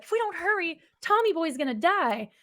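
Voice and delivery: lightly acted alarm; mock urgent warning. Retelling Tommy riding up to Michael, mock urgent.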